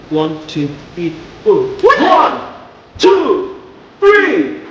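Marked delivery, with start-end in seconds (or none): 1.8-4.6 high loud count; shouted count. Shown as a demonstration. Coach demonstrating a shouted count, high and loud. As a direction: punch each number out. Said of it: "Look at what I'm doing".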